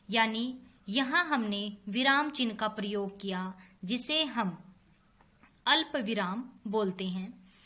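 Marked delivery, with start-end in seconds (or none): none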